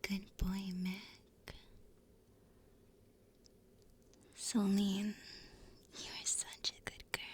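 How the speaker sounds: unvoiced whisper of praise. Real, not acted. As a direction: whisper it close to the mic. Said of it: "I can whisper you some names".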